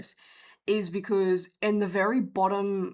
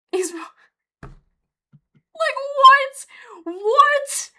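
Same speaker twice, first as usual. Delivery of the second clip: very high, loud squeal of astonishment; high-pitched, stunned outcry; shrieked disbelief; 9 dB louder, much slower than usual. Real, not acted. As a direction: let the voice leap right up, incredulous. Disbelief at the parasite story.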